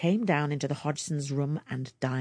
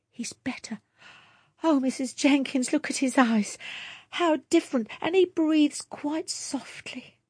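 hushed whisper rising into high, breathless relief; relieved young mother; 10 semitones higher than usual. A narrator's reading. Mother whispering to neighbour over sick baby, relieved and high. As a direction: whisper it, then lift with hope as she looks at him.

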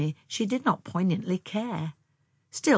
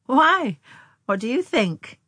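high, loud playful question; laughingly; 8 semitones higher, 7 dB louder, slower than usual. A narrator's reading. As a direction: throw it back at him teasingly. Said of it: "she laughed".

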